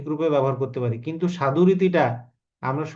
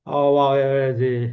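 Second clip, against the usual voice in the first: আওয়ায় বেড়েছিস drawn out slowly, a touch more menace; slow down and lean on আওয়ায় বেড়েছিস; film dialogue repeated slower; 3 dB louder, slower than usual. Retold or imitated. Teacher repeating Manna's threat, slower and heavier. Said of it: "মান্নার মুভিতে dialogue গুলো এরকম".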